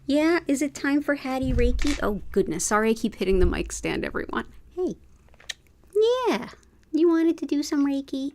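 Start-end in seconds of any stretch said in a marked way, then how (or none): 0.1-2.0 pet talk. Real, not acted. Talking to her cat Hattie. 4.8-8.3 high cooing voice to a cat; pet talk. Real, not acted. Talking to the cat.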